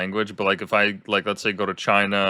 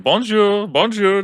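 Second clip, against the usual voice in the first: high, bright sing-song "Bonjour, bonjour" from a tourist; cheery tourist bonjour; 12 semitones higher, 6 dB louder, slower than usual. Retold or imitated. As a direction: greet everyone with a much higher, louder "Bonjour". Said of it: "he's like".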